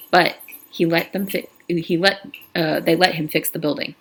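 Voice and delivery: plain reading voice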